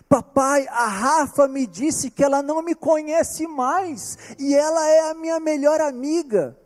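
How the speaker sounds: put-on high, distressed little-girl voice; imitating crying daughter. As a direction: mimic the daughter's sobbing complaint. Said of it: "em prantos, transtornada, chorando". (filha imitada chorando, voz aguda)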